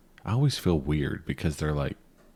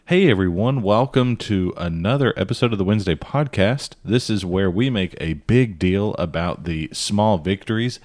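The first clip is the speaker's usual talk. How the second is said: podcast host intro; 3 semitones higher, 3 dB louder than usual. Acted.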